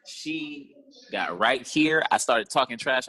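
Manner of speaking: usual speaking voice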